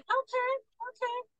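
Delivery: high, soft little okay; mock meek okay. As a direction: squeak out a small, compliant okay. Cowboy timidly takes the money.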